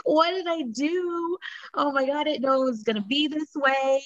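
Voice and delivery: high-pitched, exaggerated lament; retold distressed wail. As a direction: lightly imitate a tearful call to mom. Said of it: "just crying, calling my mom".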